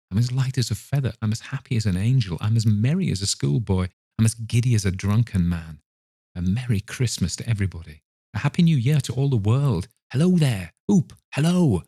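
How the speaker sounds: quick elated patter climbing into high whoops; exuberant, light-headed old man; giddy reformed miser. Acted. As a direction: let the joy bubble over into calling out.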